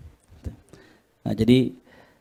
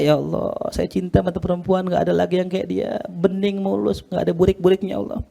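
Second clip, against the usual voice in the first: mock weeping lament; 6 semitones higher than usual. Heartbroken man lamenting the woman he left, mock weeping. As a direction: plead to God in a lightly acted weepy voice. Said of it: "nangis juga".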